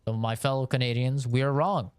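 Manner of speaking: normal talking voice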